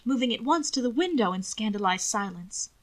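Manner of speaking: plain reading voice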